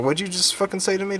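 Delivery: high, indignant demand; rude retold challenge. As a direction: act out confronting someone rudely. Retold rude confrontation with coworker.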